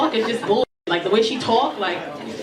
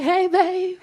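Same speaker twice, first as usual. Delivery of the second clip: higher, honeyed hey babe; butter him up, sweet and light; sweet greeting; 8 semitones higher than usual. Retold or imitated. Greeting her boyfriend sweetly before confessing.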